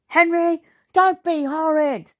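high, scolding yell; yelling mum. A narrator's reading. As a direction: yell at your naughty son. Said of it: "yelled Mum".

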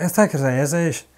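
mock incomprehensible Scottish accent; lightly imitated thick Scottish accent; garbled Scottish imitation. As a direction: slur it into thick Scottish gabble. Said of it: "Don't know how you understood that".

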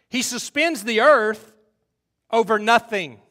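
loud, high, slow proclamation; forceful scripture declamation. Acted. Preacher reading Job on God's power, loud declamation. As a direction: ring the verse out, stretching the big words.

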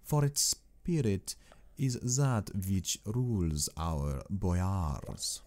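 put-on slow, stately accented Count with sweeping pitch; courtly Count Dracula. Count bidding his young guest farewell, slow and courtly. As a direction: draw out every word as a gracious, sinister host.

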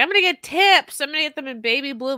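excited, high-pitched plan. Retold or imitated. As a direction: gush about it eagerly. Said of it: "I was like".

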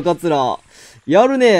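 ordinary talk

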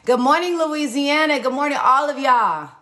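loud, high, cheerful greeting; calling out. Real, not acted. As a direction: call out to everyone across the room.

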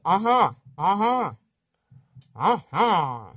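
uh-huh with nasal ah, fading. Shown as a demonstration. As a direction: practise uh-huh, O through the nose.

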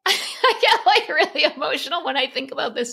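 high, tearful voice breaking with emotion; fighting back real tears, laughing through them; choked up. Real, not acted. Guest choking up while telling her podcast host about Oprah, tearful. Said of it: "oh, I'm gonna cry".

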